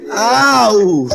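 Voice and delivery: short high yelp of pain; loud high ow as Jane falls. Acted. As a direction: yelp like you just hit the ground. Tarzan's fall punchline.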